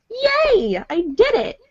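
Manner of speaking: high-pitched little "yay" of victory; triumphant cheer. Retold or imitated. Voice actor retelling getting her Fleur voice, triumphant. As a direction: cheer for yourself, starting high and easing down.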